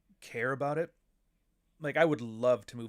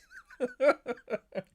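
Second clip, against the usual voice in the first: laugh; 12 semitones higher, 5 dB louder than usual. Real, not acted. Laughing at scam story.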